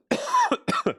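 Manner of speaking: sudden harsh coughing fit; choking cough. Real, not acted. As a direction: cough hard, choking on ice. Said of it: "I just choked on a piece of ice".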